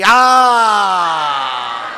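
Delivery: long, very loud open-throated scream; full scream. Shown as a demonstration. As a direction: let it all out in one sustained yell. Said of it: "Let's try to scream out loud right now".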